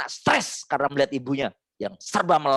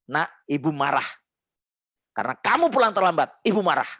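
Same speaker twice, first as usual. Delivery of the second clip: demo firm scolding; 2 semitones higher than usual. Shown as a demonstration. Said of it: "Yuk, langsung praktek. Lihat ini".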